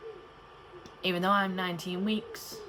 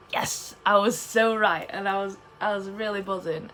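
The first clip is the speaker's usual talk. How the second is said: excited; 2 semitones higher, 5 dB louder than usual. Retold or imitated. Thrilled at being right about gender.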